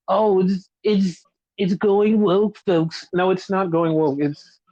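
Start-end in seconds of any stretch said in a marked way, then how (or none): 0.1-3.1 slow, stretched-out mock alarm; mocking complainer. Ranter imitating people who cry woke at sci-fi, mocking. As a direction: drag it out, imitating a silly complainer.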